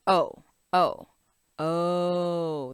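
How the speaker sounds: a drawn-out rounded "O"; long O sound. Shown as a demonstration. Teacher modelling the target sound for kids. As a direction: repeat "O" as a pure long vowel. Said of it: "the sound that we're looking for".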